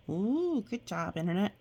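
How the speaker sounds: usual speaking voice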